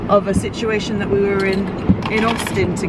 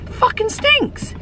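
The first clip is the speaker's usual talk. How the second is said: disgusted; slower than usual. Retold or imitated. Retold complaint, drawn out.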